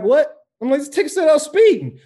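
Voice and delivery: high, loud retold objection; incredulous. Driver reading a speeding ticket, incredulous.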